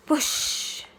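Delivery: breathy high whoosh sound; unvoiced whoosh noise; whispered whoosh. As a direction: blow it out like wind. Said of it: "says Honey".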